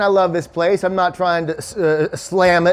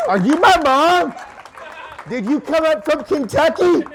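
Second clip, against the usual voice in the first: mock astonishment; 8 semitones higher than usual. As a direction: call out high, as if finding a long-lost mother. Mock-amazed call to audience member.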